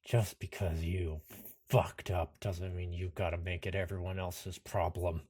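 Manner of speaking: low, soft, controlled confrontation; quiet defiance. Acted. Anderson confronting the villain over his problem, quiet defiance. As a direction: hold the anger down.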